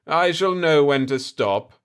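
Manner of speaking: lordly and sure of himself; haughty potentate. Acted. Aga Khaga speaks haughtily.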